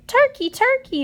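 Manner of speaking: high, loud sugary call; sweet calling. A narrator's reading. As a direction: coax the turkey sweetly from afar. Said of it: "called in his sweetest voice".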